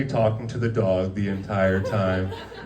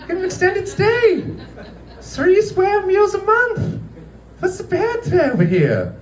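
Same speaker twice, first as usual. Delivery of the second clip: oversell how nice the camp is; mock villain reassurance. Owners pictured as cheerful villains, very high mock voice. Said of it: "kinda like, like Nazis".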